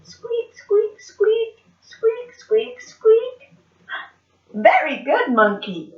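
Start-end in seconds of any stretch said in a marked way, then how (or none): 0.0-3.6 high little squeaks; high-pitched repeated mouse noises. Acted. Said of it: "A mouse is quiet".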